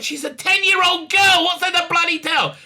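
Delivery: loud, high indignant retort; mock-indignant yell at a silly question; exasperated outburst. Retold exasperated answer to questions.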